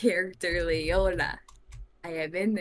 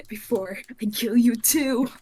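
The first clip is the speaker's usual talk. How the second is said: loud, raised mock threat; threatening; 4 semitones higher, 9 dB louder than usual. Adventurer with knife to cashier's throat, loud mock menace. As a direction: threaten loudly through the amusement. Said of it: "with a knife to his throat".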